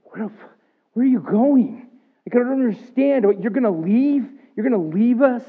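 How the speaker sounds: high, loud, anguished questioning; distressed plea. Retold or imitated. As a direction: cry out as if being abandoned. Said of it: "They're more like".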